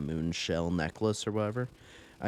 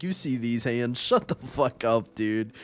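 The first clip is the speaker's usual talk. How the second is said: raised, macho retort; loud mock tough guy; 5 semitones higher, 5 dB louder than usual. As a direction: boast it loudly like a tough guy. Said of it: "I'm like".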